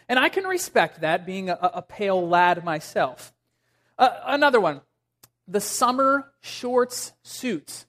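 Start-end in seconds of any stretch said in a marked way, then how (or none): 5.5-7.8 careful, word-by-word slow delivery. Real, not acted. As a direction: space out each word deliberately. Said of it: "have to say that very slow".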